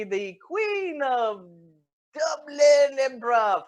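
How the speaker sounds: slow, loud mock announcement; grand proclamation. Caller boasts of a lost future, grand shout. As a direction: build to a drawn-out shout.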